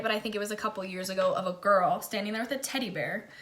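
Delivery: normal talking voice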